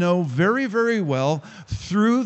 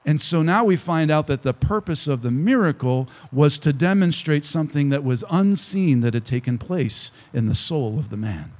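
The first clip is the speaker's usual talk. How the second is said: ordinary talk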